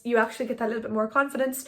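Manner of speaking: normal talking voice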